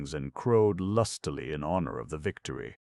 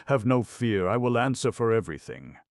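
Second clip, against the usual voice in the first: bold promise; 7 semitones higher, 6 dB louder than usual. A narrator's reading.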